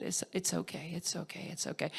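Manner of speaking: gently calm a sobbing friend; quiet reassuring murmur; soothing hush. Retold or imitated. Mentor comforting her while she sobs.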